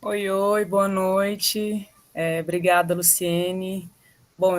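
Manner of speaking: ordinary delivery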